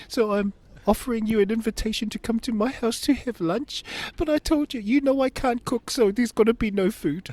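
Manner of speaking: loud, very high blubbering invitation; mock tearful wail. Father inviting his ex-wife to lunch, hammed-up high wail.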